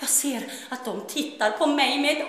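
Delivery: high, loud, sing-song pleading; Finnish-Swedish girl begs God to cure her skin; tearful begging. Acted. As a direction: beg through tears for help. Desperate girl begs for a miracle.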